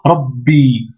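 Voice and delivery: "Rabbi" blocked on the b, then a long i; doubled consonant. Shown as a demonstration. Teacher demonstrating the shedda, "Rabbi" with doubled b, long i. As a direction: stop on "Rab", then release a held "bi". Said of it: "au-dessus de la shedda".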